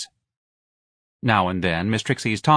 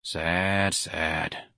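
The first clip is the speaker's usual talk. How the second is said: resigned; 4 semitones lower, 6 dB quieter than usual. A narrator's reading. Husband's dry, resigned answer.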